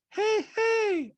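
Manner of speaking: put-on very high-pitched accented caricature; squeaky falsetto mimicry of a reality star; Diana impression. Host mimics reality star in recap, high-pitched caricature. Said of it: "And Diana goes".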